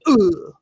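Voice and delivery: loud, high, sharp grunt; a sudden punched grunt as if hit; grunt of impact. Retold or imitated. Speaker showing how a shot man's head would jerk, with a loud grunt. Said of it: "your head would go".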